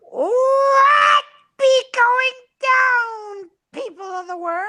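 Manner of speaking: very high, loud, slow screech; high squeaky voice. Retold or imitated. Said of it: "I'm gonna try ... get my voice that high".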